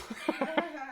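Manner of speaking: a sudden high-pitched giggle; squeaky amused giggle at a slip. Real, not acted. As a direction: burst into a quick high laugh. Laughs at own stumble.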